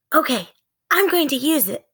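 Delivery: mildly unsure, feeling for the book; girl's voice. Acted. Annie finding the book in darkness, lightly voiced girl.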